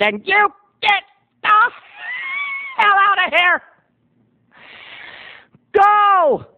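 high hammed-up shout; furious eviction. Bartender throws out the singers, shouting. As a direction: explode and order them out.

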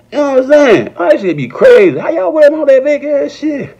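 ordinary delivery

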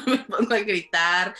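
laughing. Real, not acted. Telling of joy over messages.

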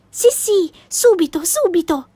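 put-on mild excited child's cry; eager exclamation. A narrator's reading. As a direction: burst out keen to go at once. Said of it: "esclamò Annika".

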